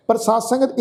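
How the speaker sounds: normal talking voice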